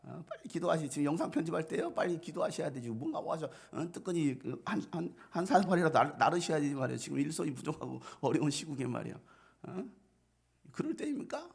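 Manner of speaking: hammed-up scolding of the pastor; confronting, nagging protest. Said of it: "따지고 있어요".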